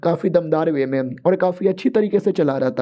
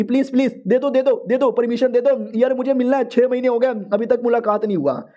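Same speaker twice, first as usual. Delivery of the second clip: higher, desperate begging cry; pleading shout; 6 semitones higher than usual. Retold or imitated. Wife begs the voice inside for permission, pitched-up pleading cry. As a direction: beg over and over, pitched up. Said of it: "तो चिल्लाने लगती".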